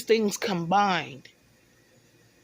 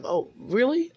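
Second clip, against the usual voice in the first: higher, mock-surprised question; retold shocked reaction; 6 semitones higher than usual. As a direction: imitate her being taken aback. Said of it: "uh, shocked".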